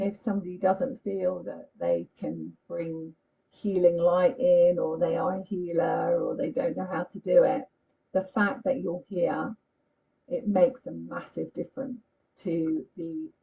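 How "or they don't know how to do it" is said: normal talking voice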